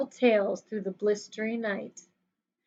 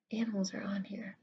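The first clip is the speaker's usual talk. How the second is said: soft whispered aside; whispering; 2 semitones lower, 12 dB quieter, faster than usual. Real, not acted. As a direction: whisper it to yourself. Aside to kids while searching.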